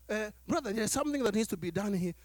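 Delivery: low, quiet, quick ask; hushed, humble request to a brother; soft polite request. Retold or imitated. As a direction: gently ask a favour. Politely asking a servant.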